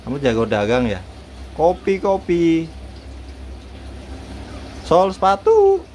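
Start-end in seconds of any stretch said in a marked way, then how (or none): 1.6-2.7 high, slow street cry; coffee seller's call. Shown as a demonstration. Owner teaches bird a vendor's cry, high and drawn out. 4.9-5.8 high street call jumping up at the end; shoe mender's cry. Shown as a demonstration. Owner teaches bird a cobbler's street cry, high.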